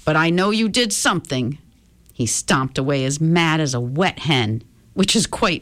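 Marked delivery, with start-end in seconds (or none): none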